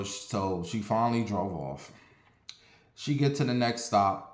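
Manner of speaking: usual speaking voice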